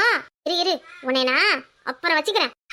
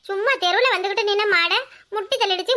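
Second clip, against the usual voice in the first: normal talking voice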